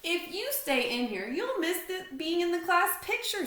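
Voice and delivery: put-on high, loud kid voice; Larry persuading. A narrator's reading. Larry urging Stanley to come to the class picture, high.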